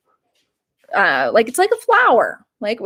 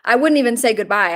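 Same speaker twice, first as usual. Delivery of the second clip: normal talking voice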